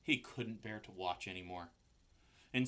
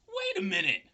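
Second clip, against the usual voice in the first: loud, high anxious pig scream; panicked call; 10 semitones higher, 11 dB louder than usual. A narrator's reading. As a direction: scream after the departing spider. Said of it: "screamed Wilbur".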